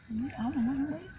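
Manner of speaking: ordinary talk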